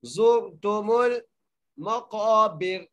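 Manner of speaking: slow melodic tajweed recitation, thin light ra in मक़ाबिर; Quranic recitation. Shown as a demonstration. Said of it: "इस रा को बारीक करके पढ़ेंगे".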